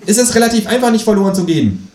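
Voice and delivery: high, cheeky kangaroo voice. Acted. Reading aloud, the narrator voices the Kangaroo asking him to pay, high and cheeky. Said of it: "lästert das Känguru".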